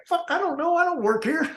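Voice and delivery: high, mock-exasperated customer voice; high-pitched mock annoyance; annoyed shopper. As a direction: act out a fed-up shopper snapping back.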